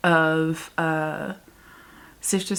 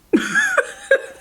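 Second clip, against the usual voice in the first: high burst of laughter; 3 dB louder than usual. Real, not acted. Laughs before saying she's mad.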